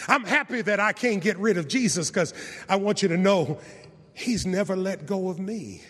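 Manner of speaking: normal talking voice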